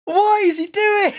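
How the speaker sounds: high, slow disbelieving cry; can't believe what he sees; shocked. Real, not acted. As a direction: fan stunned by a botched penalty. Shock at awful penalty.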